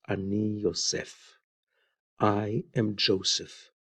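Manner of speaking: soft, hushed and a little choked, as if holding back tears; a touch of weeping in a hushed voice; quiet tearful reveal. Retold or imitated. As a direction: murmur it like a man overcome. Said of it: "He's crying so bitterly".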